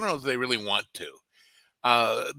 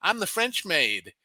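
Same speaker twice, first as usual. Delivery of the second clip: lightly imitated Southern accent, a bit higher; 6 semitones higher, 3 dB louder than usual. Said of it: "thick Southern accent".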